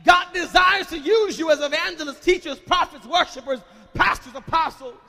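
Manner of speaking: loud, high, fervent sermon; preaching at full force. Acted. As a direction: build the crowd up and shout it out. Preaching over the music.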